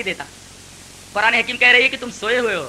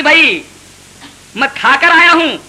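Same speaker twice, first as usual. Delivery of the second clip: higher, louder guest's polite refusal بھائی; shy guest; 6 semitones higher, 7 dB louder than usual. Acted. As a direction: act the guest protesting. Shy guest declining, acted.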